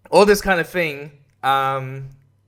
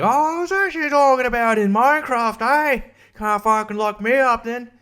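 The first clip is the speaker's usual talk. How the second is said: much higher, smug cartoon excuse; mock smug excuse; 9 semitones higher than usual. Arrested ranter claiming he meant Minecraft, high smug mock voice. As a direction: weasel out of it in a silly high voice.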